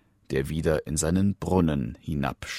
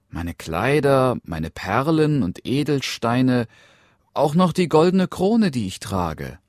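lightly voiced, higher princess; eager princess; 8 semitones higher, 5 dB louder than usual. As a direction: offer treasures one by one as a princess. Princess eagerly lists her treasures. (eifrige Prinzessin, leicht erhöhte Stimme)

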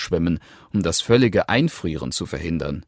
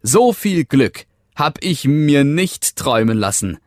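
higher, louder joyful exclaim; rejoicing; 7 semitones higher, 5 dB louder than usual. A narrator's reading. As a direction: burst out with joy from the heart. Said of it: "jubelte er".

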